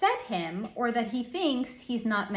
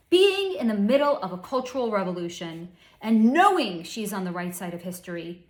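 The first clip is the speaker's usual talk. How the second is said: usual speaking voice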